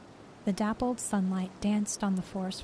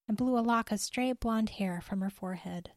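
usual speaking voice

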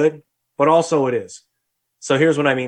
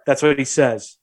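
ordinary delivery

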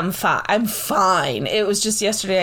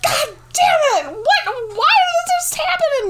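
mock outraged cry from the bottom of the stairs; retold frustrated outburst. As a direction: yell it like you just hit the floor. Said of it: "I'm like".

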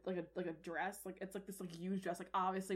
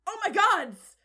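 loud, high cry of alarm; horrified father; 9 semitones higher, 13 dB louder, slower than usual. Acted. Father sees the princess bathing, horrified. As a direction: exclaim it aghast. Dad sees what is happening.

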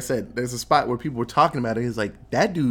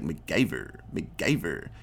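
low, hushed echo of MACGYVER; imitated echo; 8 semitones lower, 6 dB quieter than usual. Word ringing in Bart's head, low mock echo. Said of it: "echoes that word".

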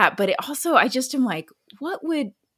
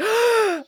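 mock gasp; 13 semitones higher than usual. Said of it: "I was like".

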